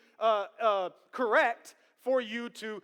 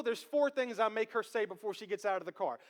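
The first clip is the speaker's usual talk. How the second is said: ordinary talk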